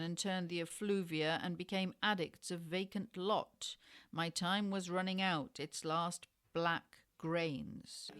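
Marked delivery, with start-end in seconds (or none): none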